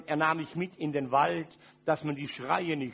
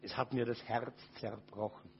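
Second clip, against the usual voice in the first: much lower and softer, near tears; very soft and lower, grieving; broken-hearted; 8 semitones lower, 7 dB quieter than usual. Real, not acted. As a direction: let it sink into a hushed grief. Heartbreak confession, voice fading.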